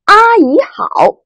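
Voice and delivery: 阿姨好 with high level 阿, drawn slowly; first tone greeting. Shown as a demonstration. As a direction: hold 阿 high and flat, slowly. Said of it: "再来读". (阿姨好，第一声，高平慢读)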